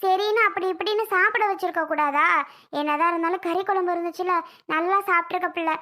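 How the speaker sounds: ordinary delivery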